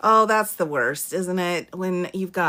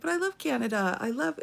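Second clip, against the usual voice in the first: higher, softer mock plea; imitated pleading; 7 semitones higher, 5 dB quieter, faster than usual. Film heroine begging Canadian embassy staff, mock pleading. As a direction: play a heroine pleading her case, lightly.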